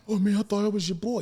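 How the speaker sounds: high, fast whiny buddy voice; mock pleading actor. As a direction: play a hurt friend asking for a favor.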